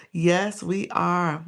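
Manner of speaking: normal talking voice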